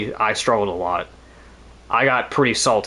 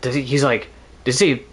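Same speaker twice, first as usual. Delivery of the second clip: ordinary talk, nothing marked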